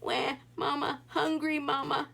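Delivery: a grown man pretending to be a baby; mock baby wail. A narrator's reading. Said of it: "as if he really was a baby".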